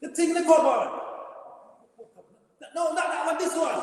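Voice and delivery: imitating mum, high and loud, a laugh slipping in; impatient mother pointing him to the right one; mum ordering.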